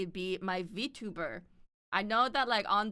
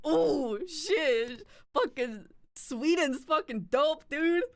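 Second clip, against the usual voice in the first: higher, louder drawn-out gushing; awed excitement; 7 semitones higher, 4 dB louder, much slower than usual. Retold or imitated. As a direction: gush with hammed-up envy. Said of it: "And I'm just like".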